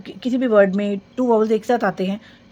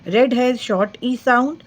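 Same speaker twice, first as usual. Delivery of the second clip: very slow reading; 3 semitones higher, 3 dB louder, much slower than usual. Shown as a demonstration. Teacher reading a rule slowly to children. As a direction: read the rule word by word, very slowly. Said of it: "rule भी read कर सकते हैं".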